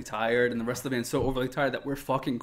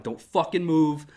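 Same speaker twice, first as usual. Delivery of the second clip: loud, higher, slow stern command; retold livid order; 6 semitones higher, 9 dB louder, slower than usual. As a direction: act out laying down the law, word by word. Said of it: "I was so mad. I was already so livid".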